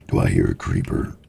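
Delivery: mock ominous growl; deep monster voice. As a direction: boom it like a cave troll. Sudden deep voice.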